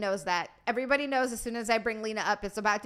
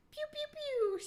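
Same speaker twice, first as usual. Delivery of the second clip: high squeaky pew pew, much higher; laser pew sounds; 8 dB quieter, slower than usual. Retold or imitated. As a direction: zap like a toy laser gun, up high. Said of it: "make laser pew pew sounds".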